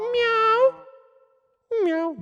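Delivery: high drawn-out cat meows; squeaky-high imitation cat. Shown as a demonstration. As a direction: meow like a little cat, way up high. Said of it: "have you meowed and mooed".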